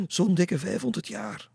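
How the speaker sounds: high, loud storybook exclamation; emphatic answer. A narrator's reading. Boy tells girl how long ago he died, emphatic.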